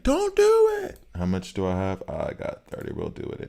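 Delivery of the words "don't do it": high, loud mock protest; hammed-up high-pitched plea; mock outcry from viewers. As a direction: shriek it like a panicking chat. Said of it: "stream is gonna be like".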